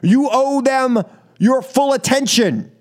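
usual speaking voice

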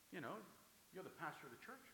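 normal talking voice

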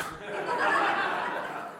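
airy high giggle; a soft laugh at the cuteness; breathy laugh. Real, not acted. Laughing at baby story.

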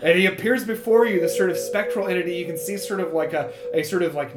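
ordinary talk, nothing marked

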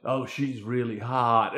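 a low, soft, sleazy guest leer. Retold or imitated. Guest eyeing Lisa at the party, low leering mutter. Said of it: "is like".

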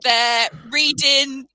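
normal talking voice

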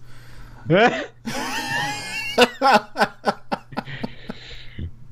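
big high laughing shout. Real, not acted. Cohost cracking up at Time Splitters joke. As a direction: burst out laughing.

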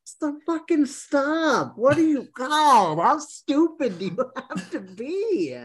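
high, slow, laughing outrage; high-pitched laughing outrage; exasperated rant. Real, not acted. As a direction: scold them in high mock fury.

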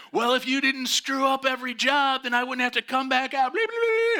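very high, loud angry retort; mock yelling back. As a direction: fire the insults right back. Enforcer yells back.

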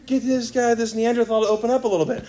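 a hint of bride cheering on the preacher; exasperated bride. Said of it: "it's usually, like, her who's like".

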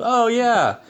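high, loud, carefree sing-out; mock breezy dismissal. Doctor casually breaking a cancer diagnosis, mocked as breezy. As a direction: imitate someone airily shrugging off bad news. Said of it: "break it to someone in that way".

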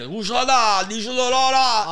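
high, loud Neapolitan yell out the window; yelling old Italian cabbie. Retold or imitated. Old cab driver yelling at another cabbie, loud Italian shout. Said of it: "to yell at another cab driver".

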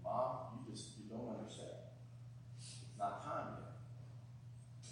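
soft, hushed and slow; gently, almost whispered; softly. Shown as a demonstration. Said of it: "but if uttered softly".

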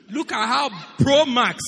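loud, laughing mockery of phone scrolling; loud mocking rant. Preacher mocks members who read WhatsApp but not Bible, loud. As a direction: ham up the phone addict, loud and amused.

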